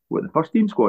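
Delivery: high, incredulous question; a retold startled double-take; retold surprise. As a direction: ask it as if you can't believe it. Retold surprise at squad selection.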